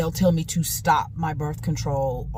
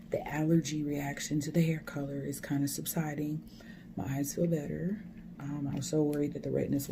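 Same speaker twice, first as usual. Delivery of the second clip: whispering; 8 dB quieter than usual. Real, not acted. Quiet talk in waiting room.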